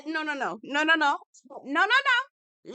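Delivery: emphatic no. Real, not acted. Rejecting quote building up.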